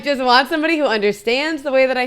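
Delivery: real laugh carrying the sentence. Real, not acted. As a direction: giggle it out while explaining. Laughing while telling her wish.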